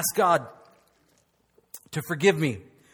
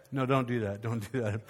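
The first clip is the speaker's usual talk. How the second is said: softer, much lower take-back; half-laughing retraction; quick aside; 8 semitones lower, 7 dB quieter than usual. Real, not acted. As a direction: take back the joke, hushed. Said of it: "pastor retracting joke".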